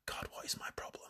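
a self-mocking whispered aside. Real, not acted. Vlogger chiding herself for rambling, in a whisper. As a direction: whisper it to yourself. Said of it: "self-mocking aside".

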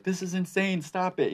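high-pitched frantic wife; panicked wife. Retold or imitated. Wife runs in on Ray, high and frantic. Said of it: "she's like".

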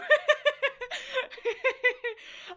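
long high giggle; high laugh. Real, not acted. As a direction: giggle uncontrollably. Laughing at robot bit.

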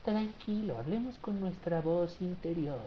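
mock gentle parent calming a tantrum; hushed, sugary mock-therapist mom; soothing mom. Permissive mom, retold softly.

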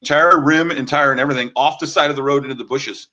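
ordinary talk

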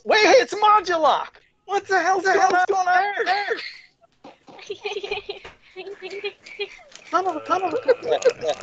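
high, startled outburst; shocked at what's on screen; surprised exclaim. Real, not acted. Surprised at a mask on stream.

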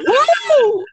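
high loud woo; excited whoop. Retold or imitated. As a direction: whoop with joy. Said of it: "And you're like".

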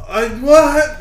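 mock shock, pitched high and loud; shocked reaction. Comic reaction to a shady island invitation, shocked. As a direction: squawk it in high-pitched disbelief.

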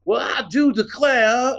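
high, slow, overdone antebellum Southern drawl; mock an actor trying too hard at Southern; bad Southern accent. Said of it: "you see these people like".

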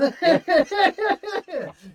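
high loud cackle; loud burst of laughter. Real, not acted. Laughing hard at the ambush story, loud cackle.

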